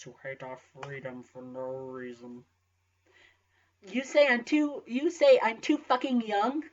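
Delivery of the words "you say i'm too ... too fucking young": incredulous. Acted. Incredulous student retort.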